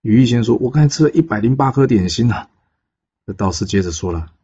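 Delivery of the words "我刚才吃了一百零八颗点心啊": higher, rising pained wail on 一百零八; pained complaint. Acted. Bird immortal tells the Taoist what he ate, pained and high. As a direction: moan it out through the stomachache.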